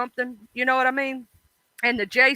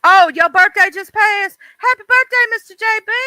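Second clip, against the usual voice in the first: excited birthday greeting; 10 semitones higher, 8 dB louder than usual. Real, not acted.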